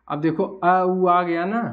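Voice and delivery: ordinary talk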